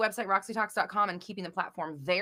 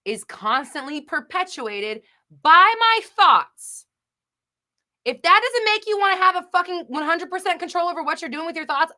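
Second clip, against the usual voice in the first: louder, higher, fed-up outburst; exasperated rant; 8 semitones higher, 10 dB louder than usual. Real, not acted. Streamer venting at her chat, exasperated and loud. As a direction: throw your hands up, can't say it plainer.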